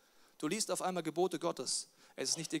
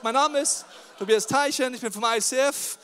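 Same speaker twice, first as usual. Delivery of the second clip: stage introduction; 6 semitones higher, 10 dB louder than usual. Retold or imitated. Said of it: "Also ich hab gesagt".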